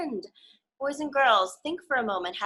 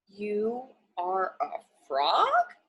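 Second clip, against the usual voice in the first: puzzled Gerald; much slower than usual. Acted.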